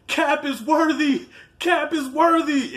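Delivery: high, slow excited cheer with a laugh. Retold or imitated. Fan cheering at the movie screen, excited high yell. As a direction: yell it out like the whole theater is with you.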